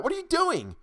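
high, panicked protest; alarmed. Acted. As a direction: cry out in panic. Being dragged away.

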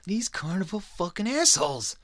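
hammed-up fed-up god raging at humans; disgusted god ranting. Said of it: "He was like".

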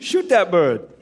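a hint of a Maine drawl on the name; lightly imitating the guide's Maine accent; mock Maine accent. Pushed to do it, imitating Scotty's Maine accent. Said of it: "Do it".

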